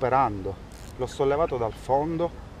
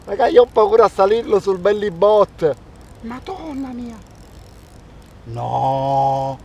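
excited and scared; 7 semitones higher, 3 dB louder than usual. Real, not acted. Fear of the octopus catch.

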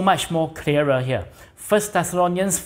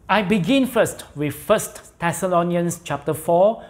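normal talking voice

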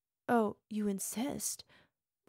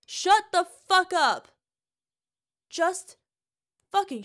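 high, loud scream; 7 semitones higher, 11 dB louder than usual. Acted. Partner screaming at her lover to shut up, full scream. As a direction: scream them into silence.

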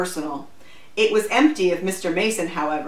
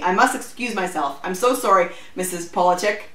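plain reading voice

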